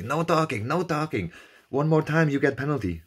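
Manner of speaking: imitating a marshal shouting a penalty warning; shouting official. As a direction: bark the rule at the cyclist.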